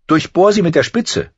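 put-on young Arya bursting out with it; blurted out. A narrator's reading. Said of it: "platzte sie heraus". (herausgeplatzt, hohe Mädchenstimme)

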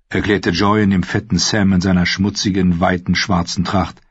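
plain reading voice

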